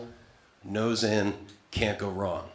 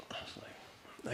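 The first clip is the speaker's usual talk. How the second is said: breathy near-silent aside; whispered; 20 dB quieter, slower than usual. Real, not acted. Comic telling the crowd in a whisper. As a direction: drop it to a whisper.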